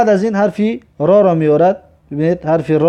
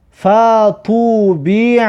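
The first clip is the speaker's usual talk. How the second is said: slow, joined syllables with long vowels; drawn-out model reading; 2 semitones higher, much slower than usual. Shown as a demonstration. Teacher models a word for learners, slow and drawn out. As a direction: stretch "فطوبیع" in one slow run.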